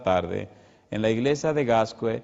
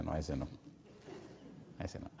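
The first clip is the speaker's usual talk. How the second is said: much softer and lower, fading away; weak mother's reply; 7 semitones lower, 17 dB quieter than usual. Retold or imitated. Dying mother answering her son, faint and low. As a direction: voice the sick mother barely answering. Sick mother rejects the devil.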